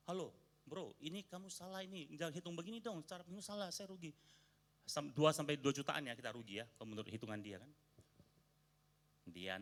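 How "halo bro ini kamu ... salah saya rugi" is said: higher-pitched mock telling-off on the phone; retold scolding. Wife phoning the guest to correct his sums, mock scolding. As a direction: scold him like the wife did, lightly. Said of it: "tegur dia".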